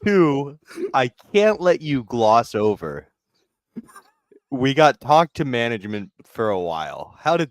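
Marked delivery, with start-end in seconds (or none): none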